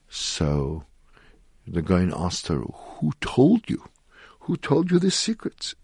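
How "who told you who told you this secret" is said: high, slow, drawn-out question; retold question full of wonder; intrigued questioning. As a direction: ask it high and slowly, probing for the secret. Gaon questions woman about her secret.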